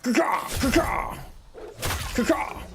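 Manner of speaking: high cawing crow call; crow caw imitation.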